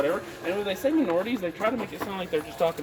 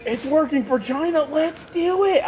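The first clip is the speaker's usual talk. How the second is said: dopey enthusiast; 8 semitones higher, 3 dB louder, slower than usual. Retold or imitated. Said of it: "there's a dude who's like".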